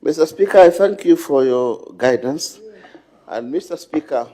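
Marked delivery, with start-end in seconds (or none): 0.0-2.6 raised voice. Real, not acted. Minister resuming amid uproar.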